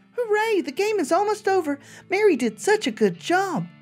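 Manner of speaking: sweet and unsettling talking doll; too calm creepy doll. Acted. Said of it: "its mouth begins to move".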